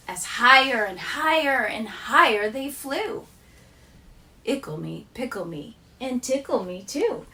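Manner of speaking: bouncy sing-song reading of a rhyming poem; poem recital. Acted. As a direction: read it like a playful nursery rhyme. Teacher reads rhyming poem.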